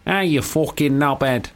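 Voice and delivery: slow Manchester insult; lightly voiced Manchester insult; Manchester accent. Shown as a demonstration. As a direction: drag it out like a Manc lad. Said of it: "for Manchester, I would have to go".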